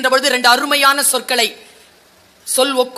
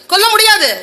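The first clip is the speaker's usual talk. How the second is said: loud refusal; 8 semitones higher, 6 dB louder than usual. Retold or imitated. Arjuna refuses loudly.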